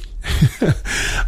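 quiet, wordless chuckle; soft chuckle. Real, not acted. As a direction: chuckle softly to yourself. Chuckles while introducing the story.

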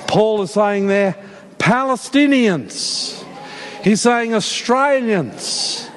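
slow, drawn-out scripture exposition; emphatic preaching. Real, not acted. Preacher rereading God's plan to the church, slow emphatic preaching. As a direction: stretch each key word and let it land.

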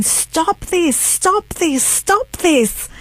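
high, pleading cry of protest; imitated upset mother. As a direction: mimic a distraught mum, pitch leaping up on each repeat. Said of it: "my mum got so upset".

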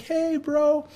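high bright hey bro; cheery greeting. Retold or imitated. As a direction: greet your buddy happily. Greeting friend at door.

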